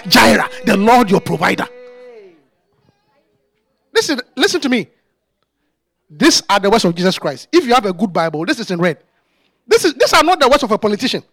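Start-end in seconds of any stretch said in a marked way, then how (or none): none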